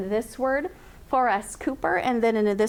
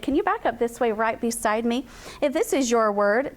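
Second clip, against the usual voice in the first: ordinary talk, nothing marked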